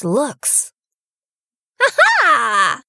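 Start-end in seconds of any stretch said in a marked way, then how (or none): 1.8-2.8 mocking witch laugh. A narrator's reading. Witch laughing at the prince, mocking laugh. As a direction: cackle in triumph at the prince. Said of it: "she cried mockingly".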